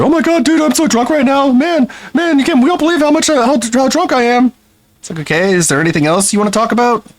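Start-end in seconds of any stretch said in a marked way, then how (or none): 0.0-4.5 high mocking voice of a tipsy teenager; drunk teen mockery. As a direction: brag like a kid after one beer. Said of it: "Fifteen-year-old's first beer".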